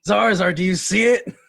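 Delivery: loud cry across the fire; calling out. Acted. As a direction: call out to him, straining. Character calls out to Zarzar.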